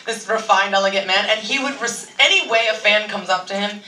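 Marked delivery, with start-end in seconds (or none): none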